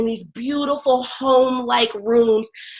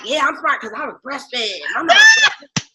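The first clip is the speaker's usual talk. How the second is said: mock bragging boyfriend; 4 semitones higher than usual. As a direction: tease him by copying his boast.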